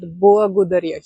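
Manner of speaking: благодаря with the L rounded toward W; throaty L. Shown as a demonstration. Teacher shows students how to imitate the Bulgarian L. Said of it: "here in the throat".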